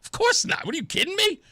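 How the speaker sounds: high, breathy "Of course not", retold; imitating his own shocked reply; mock incredulity. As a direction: scoff as if the idea is absurd. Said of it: "I'm like".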